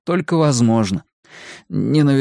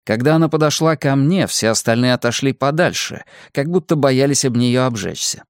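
ordinary delivery, nothing marked

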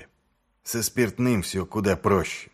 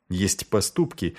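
plain reading voice

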